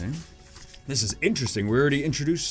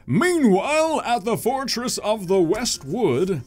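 higher, grand announcer narration; dramatic scene announcer; 11 semitones higher, 4 dB louder, much slower than usual. Acted.

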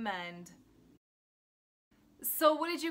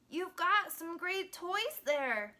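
higher, slower storybook character voice; lightly voiced friendly dog; 7 semitones higher, slower than usual. A narrator's reading. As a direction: voice a little dog, pitched up and unhurried.

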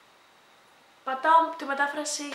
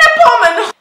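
much higher and louder, sharp; exasperated call; 10 semitones higher, 16 dB louder than usual. Real, not acted. Vlogger giving up on a phrase, loud exasperated call. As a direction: shout it to move on.

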